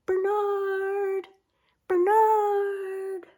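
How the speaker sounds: high distant calling voice. Acted. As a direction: call his name into the night. Otters calling through the night.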